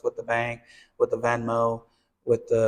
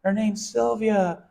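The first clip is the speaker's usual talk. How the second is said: put-on small boy; 10 semitones higher, slower than usual. Boy voiced in retold story.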